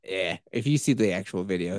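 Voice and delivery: ordinary talk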